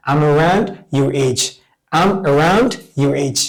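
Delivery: unhurried and clear; slow model answer. Shown as a demonstration. Teacher giving students a model answer, slowly. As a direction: say it slowly for copying. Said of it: "teacher models an answer".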